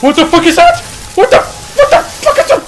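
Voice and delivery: very high, loud shriek of alarm; real panicked scream. Real, not acted. Player spotting something in the water, terrified scream. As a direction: scream it in sudden fright.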